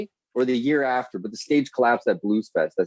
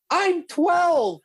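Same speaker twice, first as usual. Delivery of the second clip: put-on young girl's voice. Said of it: "and he goes".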